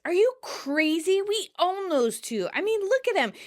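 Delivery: high-pitched exasperated protest; Monica, exasperated. Retold or imitated. Monica refusing to ease up on opponents, exasperated. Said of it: "Monica, exasperated".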